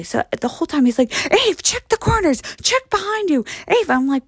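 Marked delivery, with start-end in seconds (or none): 1.3-3.9 high-pitched mock-urgent warnings; overly serious dad. Daughter mimics dad warning her in a haunted house, urgently. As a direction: imitate a dad on high alert. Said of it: "he takes it very seriously".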